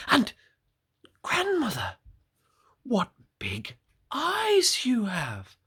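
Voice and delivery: put-on high girl voice sinking to a slow hush on the last words; wondering little girl. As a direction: ask in awe, high then hushed. Girl questions again.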